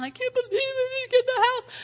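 high, whiny mock tantrum; mock meltdown. Churchgoer imitating a believer's whiny meltdown. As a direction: whine it like a spoiled kid who lost out. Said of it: "you have a meltdown".

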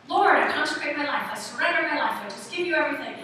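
loud, emphatic prayer of surrender; fervent prayer. Acted. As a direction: pray it out boldly, as a demonstration.

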